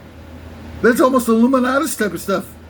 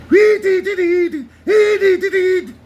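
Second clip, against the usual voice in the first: much higher, louder chipmunk gibberish; sped-up song; 10 semitones higher, 4 dB louder than usual. Shown as a demonstration. Showing the song at normal speed, high chipmunk gibberish. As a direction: squeak it out fast like a sped-up tape. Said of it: "normal speed".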